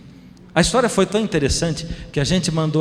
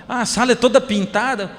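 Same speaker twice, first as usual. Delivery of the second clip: put-on child voice; 8 semitones higher than usual. Son answers father in car.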